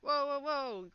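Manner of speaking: high, drawn-out protest; alarmed backing off. Acted. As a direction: hold off an armed guard. Guards reach for their swords.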